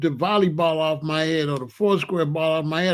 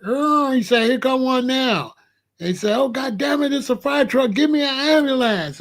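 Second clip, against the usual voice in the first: higher, excited cop voice; mock cop voice; 8 semitones higher than usual. Policeman spotting a fire truck, higher flustered voice.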